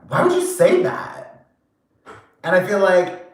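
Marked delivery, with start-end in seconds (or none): 0.1-1.5 high, slow, incredulous squeak of a question; shocked disbelief. Retold or imitated. To a rude person, slow high-pitched disbelief. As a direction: sound stunned that anyone would say it. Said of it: "you're like".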